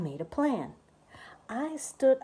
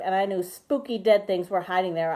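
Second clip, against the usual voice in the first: plain reading voice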